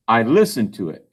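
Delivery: ordinary delivery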